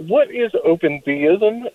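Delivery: ordinary talk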